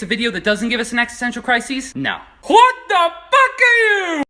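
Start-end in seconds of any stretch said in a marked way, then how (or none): 2.4-4.2 high, slow disgusted disbelief; horrified surprise. Real, not acted. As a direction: stretch it out in horror. Seeing a strange creature.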